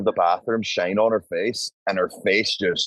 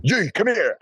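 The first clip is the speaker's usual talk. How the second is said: put-on calling a girl over, much higher; high excited call; 10 semitones higher than usual. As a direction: beckon her, keyed up.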